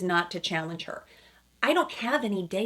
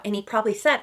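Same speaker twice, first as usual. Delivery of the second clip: ordinary delivery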